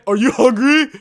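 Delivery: mocking interviewer. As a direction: parrot the dumb question back in a silly voice. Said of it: "people coming up saying".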